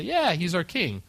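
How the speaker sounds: pitched high, cheering the new king; hammed-up crowd acclaim; mock crowd cheer. Crowd crowning Saul, acted quote.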